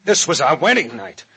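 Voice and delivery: loud, high, scornful outburst; bitter outrage. Acted. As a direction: throw the excuse back at her. Husband rejects wife's excuse.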